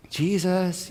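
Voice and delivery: soft, tender call of his name; gentle wake-up call. Retold or imitated. Gently waking a sleeping child, soft call. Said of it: "they didn't wake him up like".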